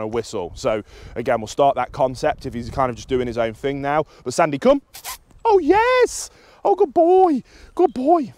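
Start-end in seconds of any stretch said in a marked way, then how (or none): 4.3-4.8 higher, louder call; recall cue. Real, not acted. Trainer calls the dog back on a long line, recall cue. 5.5-8.3 very high, loud squealed praise; excited praise. Real, not acted. Trainer rewards the dog for coming back, excited praise. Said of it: "he's getting vocal inflection".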